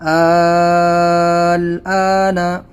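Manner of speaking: آل آنا with the first vowel held very long; long madd lazim. Shown as a demonstration. Teacher showing pupils the longest madd. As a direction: stretch the madd fully. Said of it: "یہاں پر مد لازم".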